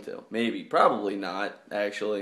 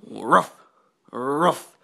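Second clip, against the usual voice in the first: dog barks. Pretend. Barking at sleeping girlfriend.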